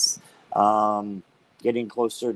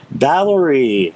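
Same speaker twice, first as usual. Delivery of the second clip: louder, slow call of a name; drawn-out louder call; 3 semitones higher, 8 dB louder, slower than usual. Real, not acted. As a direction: call her into the room. Calling out for a viewer.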